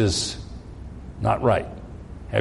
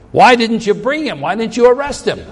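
louder, higher accusing question; indignant demand; 8 semitones higher, 5 dB louder than usual. Acted. Pharisees demand of their officers, indignant and raised. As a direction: chief priests scolding the officers.

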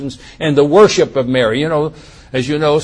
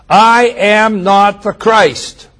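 slow, louder, higher declaration; solemn declaration; 5 semitones higher, 7 dB louder, much slower than usual. Acted. Preacher reading John's denial, slow and solemn. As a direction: proclaim it slowly with weight. Reads scripture aloud.

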